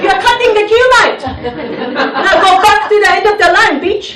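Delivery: high, loud, fast rant in Aussie slang; hammed-up angry shouting; mock Aussie telling-off. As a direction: yell like an outraged Aussie in a queue. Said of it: "I can say something like".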